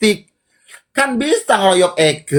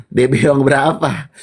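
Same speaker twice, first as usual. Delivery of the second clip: usual speaking voice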